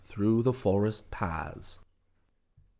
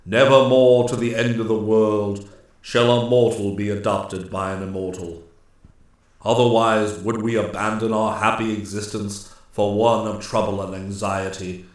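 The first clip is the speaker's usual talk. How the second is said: loud, weighty ruling; solemn Master Woodsman; 6 dB louder than usual. A narrator's reading. Master Woodsman warns the nymphs as he relaxes the law, solemnly. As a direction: pronounce it as a measured, final warning.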